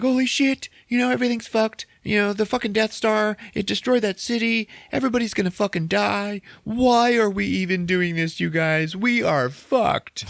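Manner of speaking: high, strained whining complaint; mock high-pitched rebel panic; panicked rebel whine. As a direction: whine like everything is lost. Panicking rebels in war room.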